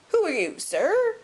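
high, loud crotchety demand; querulous old man. A narrator's reading. Old man demanding the stranger's name, querulous and high. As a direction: snap the question, soaring up on sir. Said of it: "a querulous voice".

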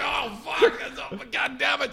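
high, loud startled swear; shocked curse. Retold or imitated. Realising it's the same road, startled high curse. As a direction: blurt it in dismay. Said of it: "I'm like".

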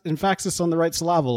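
EMPHASIS as em-FASS-is, SYLLABLE as sil-LAH-bul; joke misplaced stress. Shown as a demonstration. Host praising co-host with an old joke, misplaced stress on EMPHASIS and SYLLABLE. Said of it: "you put the right inflex".

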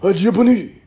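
loud, high mock Japanese accent. Comedian imitating angry languages, mock Japanese accent. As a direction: bark it in a put-on Japanese accent. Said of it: "they sound so angry".

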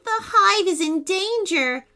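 high, urgent bee voice; worried storybook bee; alarmed warning. A narrator's reading. Bee warns queen of danger.

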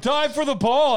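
high loud mock coach order, laughing; coach yelling. Coach orders the goalie, loud high laughing. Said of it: "It's like".

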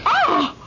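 sharp, high cry; cry of pain. Acted. Wife slapped by her husband, high cry of pain. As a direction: cry out suddenly as if struck.

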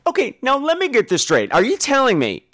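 ordinary delivery

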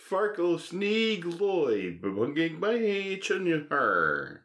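very slow high nonsense; slow gibberish. Real, not acted. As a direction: drag out gibberish. Garbled stalled word.

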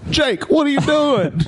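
high, drawn-out coach holler; Coach McCullough. Retold or imitated. Coach catches a boy in the locker room, high drawn-out coach voice. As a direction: stretch it out like an incredulous coach. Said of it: "That's exactly how he sounds".

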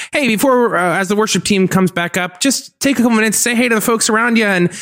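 high, chipper church announcer; upbeat stage announcer voice; announcements guy. Retold or imitated. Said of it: "the announcements guy is, like".

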